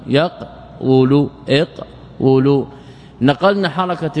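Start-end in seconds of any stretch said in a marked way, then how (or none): 0.1-1.3 يقول said slowly as yaq, pause, wulu; split underlying form. Shown as a demonstration. Teacher showing the vowel transfer, يقول split yaq-wulu. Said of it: "حصل إعلان بالنقل". 1.5-2.7 اقول said slowly as iq, pause, wulu; split underlying form. Shown as a demonstration. Teacher showing the vowel transfer, اقول split iq-wulu. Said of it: "حصل إعلان بالنقل".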